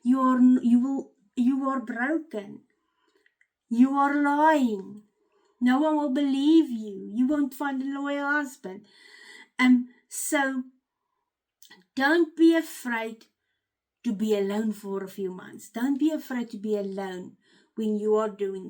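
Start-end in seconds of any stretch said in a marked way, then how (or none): none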